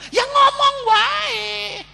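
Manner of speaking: high, long-drawn yell; loud, soaring, exasperated urging; exasperated shout. Acted. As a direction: shout it out, stretching the last word. Preacher exasperated, urging to just speak.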